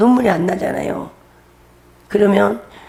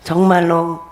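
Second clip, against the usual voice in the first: usual speaking voice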